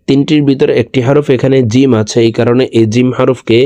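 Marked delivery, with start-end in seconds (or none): none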